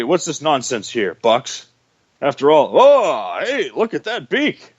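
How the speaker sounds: usual speaking voice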